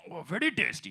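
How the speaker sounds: put-on appreciative eater with an Indian accent. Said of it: "This is every Indian eating".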